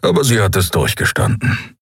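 ordinary delivery, nothing marked

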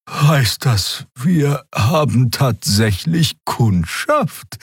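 high, very slow question; wondering character. A narrator's reading. Crew member hearing they might have a customer, slow wonder. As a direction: ask it drawn-out in a high character voice. Xea asks about the news.

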